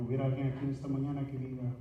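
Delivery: ordinary talk, nothing marked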